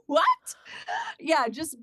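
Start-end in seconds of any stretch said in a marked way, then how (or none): 0.1-0.6 gasp, then high, loud laughing "what"; squealed, incredulous reaction; mock shock. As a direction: act out stunned disbelief with a laugh. Acted shocked reaction to the insult.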